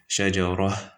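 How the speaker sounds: "shajara" ending in a breathed h; stop with ha. Shown as a demonstration. Teacher showing the feminine ending, stop with ha. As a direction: close "shajara" on an audible h. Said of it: "with a ha".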